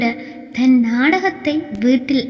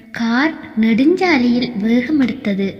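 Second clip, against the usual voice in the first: ordinary delivery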